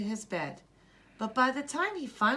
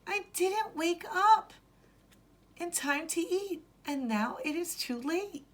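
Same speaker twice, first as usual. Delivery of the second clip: put-on Zaki's child voice; 11 semitones higher than usual. Boy fears he missed the meal, high child voice.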